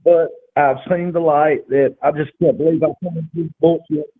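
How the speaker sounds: ordinary talk, nothing marked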